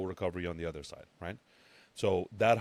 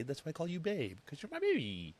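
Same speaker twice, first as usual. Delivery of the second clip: put-on cooing baby talk; 9 semitones higher, 3 dB quieter than usual. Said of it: "teasing viewers as babies".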